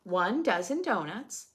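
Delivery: ordinary talk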